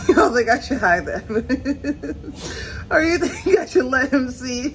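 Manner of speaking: questions broken by laughter, last words slow; laughing. Real, not acted. Asking viewers whether to hide the mess, laughing. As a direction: ask it while giggling at yourself.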